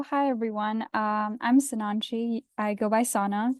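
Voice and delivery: usual speaking voice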